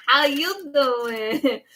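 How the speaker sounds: very slow, stretched pickup line; hammed-up flirt, laughing at yourself; mock flirty. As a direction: draw out every word teasingly. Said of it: "really talk like that".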